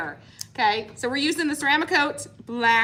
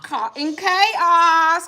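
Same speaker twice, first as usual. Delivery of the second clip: excited; 7 semitones higher, 10 dB louder, much slower than usual. Real, not acted. Excited shout-out to sponsor.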